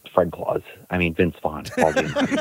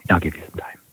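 whisper. Real, not acted. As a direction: whisper it close to the mic. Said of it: "The sexy whispers".

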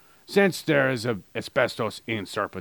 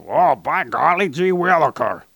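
higher, louder goofy folksy voice; imitating Purple; 4 semitones higher, 6 dB louder than usual. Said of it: "He was talking like this".

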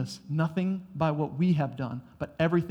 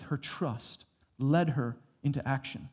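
ordinary talk, nothing marked

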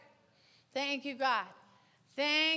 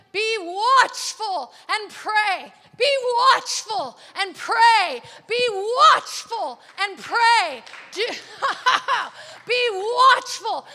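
louder, slow, urgent charge; forceful exhortation; 7 dB louder than usual. Real, not acted. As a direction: drive each word home like a preacher.

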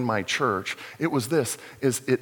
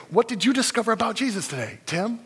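eager question; 9 semitones higher than usual. Retold or imitated. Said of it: "he'd say".

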